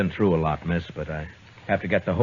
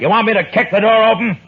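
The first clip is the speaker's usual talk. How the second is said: loud, much higher threatening yell; angry shout; 9 dB louder, faster than usual. Acted. Marshal threatening Carter through the door, angry shout. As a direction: blast it as a final warning.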